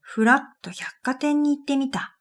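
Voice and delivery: ordinary talk, nothing marked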